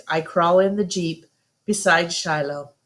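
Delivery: usual speaking voice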